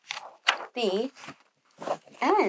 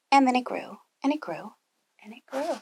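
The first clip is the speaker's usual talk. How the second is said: each repeat softer until almost a whisper; storyteller fading the repeats to a hush; fading away; 2 semitones higher, 5 dB quieter than usual. A narrator's reading. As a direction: let the words die away. Beanstalk growth told in triplet.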